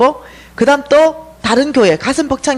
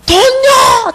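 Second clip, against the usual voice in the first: much higher, louder, near tearful cry; 12 semitones higher, 5 dB louder, much slower than usual. Retold or imitated. In debt, she cries out to heaven for money. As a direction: wail the word as a desperate plea. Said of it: "소리가 정말로".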